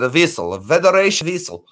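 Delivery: put-on vessel said visel in a light Russian accent; Chekhov's Russian accent. Showing how Chekhov said vessel, light Russian accent. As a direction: play the Russian-accented starship officer lightly. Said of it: "That's how we said".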